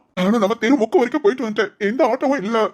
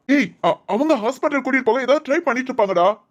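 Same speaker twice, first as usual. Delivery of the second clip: ordinary talk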